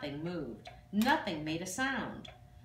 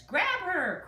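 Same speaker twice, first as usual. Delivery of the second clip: high, loud commanding cry; cried out; 12 semitones higher, 6 dB louder than usual. Acted. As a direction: shout the order at the guards. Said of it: "cried the queen".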